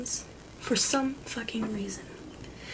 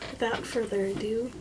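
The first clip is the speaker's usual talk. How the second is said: ordinary delivery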